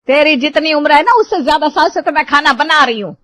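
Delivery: loud, high indignant scold; angry scolding. Acted. Mother-in-law scolding her daughter-in-law about cooking, loud and angry. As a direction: put the young one in her place.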